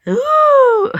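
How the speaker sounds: excited squeal. Real, not acted. Gamer reacting to the game.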